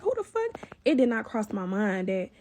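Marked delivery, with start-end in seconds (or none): 0.0-0.6 high, startled, under the breath; a sudden jolt of alarm. Retold or imitated. Startled by the banging.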